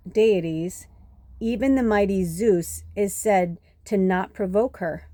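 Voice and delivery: slow, measured book reading; reading aloud. Acted. Reading a book passage on Nyx to viewers, measured read-aloud. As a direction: read it out slowly, with pauses between phrases.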